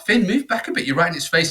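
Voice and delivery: high sharp call to son; urgent warning. Retold or imitated. Dad warning son off the venomous snake, high urgent call. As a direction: get him away from it now.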